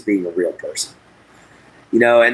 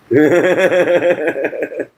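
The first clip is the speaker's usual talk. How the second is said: amused laugh; 6 semitones higher than usual. Real, not acted. Laughs about cheeseburger.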